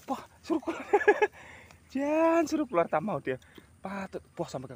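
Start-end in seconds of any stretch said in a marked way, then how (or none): 0.9-1.5 loud high laugh. Real, not acted. Laughing loudly as the eel refuses to come out.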